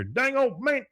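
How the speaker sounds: very high-pitched exclamation; mock yelp. Heard from outside amid the fire, high imitated yelp. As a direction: cry it out high, imitating a flustered neighbour. Retold neighbour crying out.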